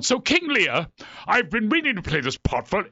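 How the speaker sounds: high, imitated earnest thespian; Patrick Stewart. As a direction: promote the play with mock actorly seriousness. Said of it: "he's real serious".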